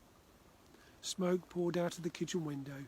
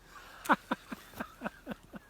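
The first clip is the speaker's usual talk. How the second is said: put-on uncontrollable child giggle, higher; high giggling laugh; 10 semitones higher, 4 dB louder than usual. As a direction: laugh helplessly. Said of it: "laughed uncontrollably".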